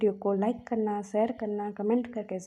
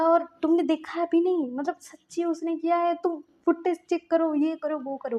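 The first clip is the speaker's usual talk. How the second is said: higher and louder, urgent protest; desperate pleading; 8 semitones higher, 7 dB louder than usual. Acted. Myamya pleads with Lajan in the hospital: high, loud, desperate insistence. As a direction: insist loudly that he did it himself.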